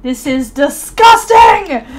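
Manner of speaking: loud, slow, drawn-out disgust; disgusted shout as the Sim. Pretend. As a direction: shout it out slowly like a grossed-out wife. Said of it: "And she's like".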